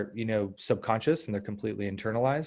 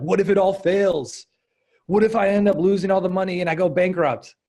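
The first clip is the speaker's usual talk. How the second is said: mock panicked worry; 9 semitones higher, 9 dB louder than usual. Coach imitating a stressed entrepreneur client, panicky. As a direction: play the stressed entrepreneur spiraling. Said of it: "really stressed out about their, you know, their business".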